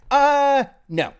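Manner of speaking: a sudden loud, high "no"; punchy loud denial; loud deadpan no. Real, not acted. Sudden letdown about the peak.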